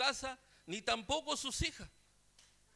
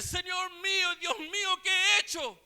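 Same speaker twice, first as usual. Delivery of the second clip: much higher, louder stricken exclamation; alarmed cry; 10 semitones higher, 8 dB louder than usual. Retold or imitated. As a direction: cry out in sudden horror to God.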